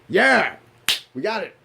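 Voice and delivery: high, loud victory yell; triumphant cheer. Retold or imitated. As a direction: celebrate like you just won. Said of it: "half of me was like".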